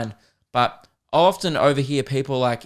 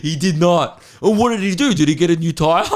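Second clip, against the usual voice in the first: louder, higher excited gasping; hyped-up mates; 3 semitones higher, 5 dB louder than usual. Retold or imitated. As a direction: gush over a boring story like it's gripping. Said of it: "his mates are like".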